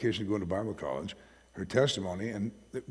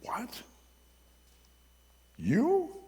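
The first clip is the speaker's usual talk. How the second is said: leaping high squeak of disbelief; shocked surprise. Retold or imitated. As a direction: sound truly floored by the news. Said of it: "what she got from me was surprise".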